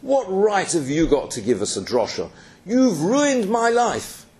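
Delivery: rising high and slowing on the accusation; mock angry scream. Heckler in the hall accusing the lecturer, mock furious. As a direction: hurl the abuse at the speaker on stage. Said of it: "started screaming abuse at her".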